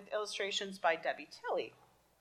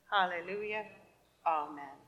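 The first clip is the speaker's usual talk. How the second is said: usual speaking voice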